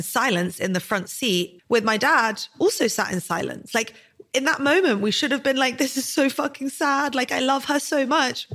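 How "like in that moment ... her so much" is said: fighting back tears while getting the words out; real crying. Real, not acted. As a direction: voice pitched up and strained with real tears. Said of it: "I can't believe I'm crying".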